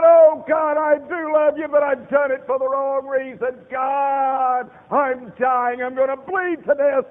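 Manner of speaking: high, loud pleading to God while dying; desperate shouted prayer. Retold or imitated. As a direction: cry out for forgiveness. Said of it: "And I said".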